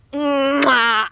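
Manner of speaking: big loud mwah kiss sound; loud smacking kiss. Real, not acted. Man kisses his goose, loud smacking mwah. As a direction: plant a loud smooch.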